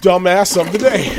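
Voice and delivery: loud, high show-segment announcement; hyped announcement. Acted. Introducing his regular segment to viewers, loud announcer call. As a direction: boom out the segment title like a host.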